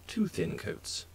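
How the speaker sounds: put-on British-accented catchphrase; imitated British accent, husky and hushed; Duncan. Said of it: "in a British accent".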